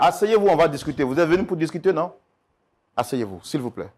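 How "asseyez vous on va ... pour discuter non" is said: higher and louder, firm; loud appeal. Real, not acted. Mediator urging the couple to sit and talk, loud. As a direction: raise your voice to restore order. Mediator calls over the quarrel.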